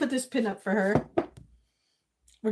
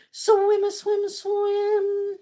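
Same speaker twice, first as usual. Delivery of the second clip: playful sing-song; 9 semitones higher, much slower than usual. Real, not acted. Playful repetition.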